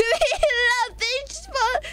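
high laughing punchline. Real, not acted. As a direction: crack up mid-joke. Laughing at own joke.

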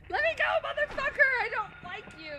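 mock panicked yelling; shouting. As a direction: yell it like you're grabbed. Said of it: "I'm like".

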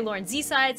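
"Z-side" as two words, zee then side; name pronunciation variant. Shown as a demonstration. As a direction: say "Z-side" with a full zee syllable before side. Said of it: "no real way to say my name".

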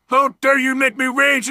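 ordinary talk, nothing marked

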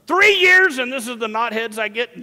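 high, loud burst, then easing off; mock exasperation. Preacher playing a fed-up Jesus, mock outrage.

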